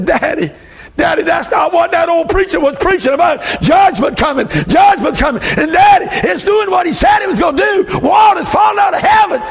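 high, shouted plea to Daddy, building; a preacher crying out as a panicked child; alarmed little boy. Retold or imitated. Boy warns Daddy as flood starts.

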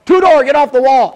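loud, high yell of the name; hollering. Retold or imitated. Father hollering at his son on the wall, loud and high. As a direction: holler it across the room. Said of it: "and he'd holler".

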